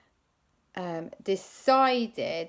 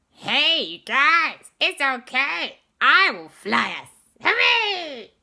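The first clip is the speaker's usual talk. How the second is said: loud, high, excited Gru impression; 8 semitones higher, 8 dB louder, slower than usual. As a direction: boom it out like Gru, cheerful. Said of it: "that's exactly how he talks".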